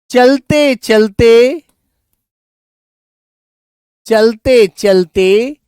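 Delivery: drawn-out words with pauses for writing; teacher dictating an example sentence slowly; slow example read-out. Shown as a demonstration. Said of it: "जैसे बेटा मैंने बोला".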